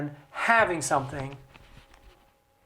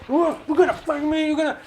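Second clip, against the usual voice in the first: higher, pushed mock brawler threat; lightly acted fight talk; mock yelling; 8 semitones higher, faster than usual. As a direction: play a riled-up representative picking a fight. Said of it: "they yell and they scream".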